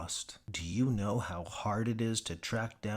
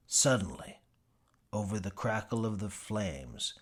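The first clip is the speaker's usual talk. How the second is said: ordinary delivery, nothing marked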